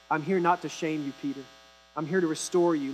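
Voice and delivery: a hint of tender Jesus; gentle reassurance. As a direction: reassure him quietly. Jesus restoring Peter, gently.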